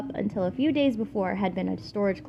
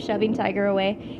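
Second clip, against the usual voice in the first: usual speaking voice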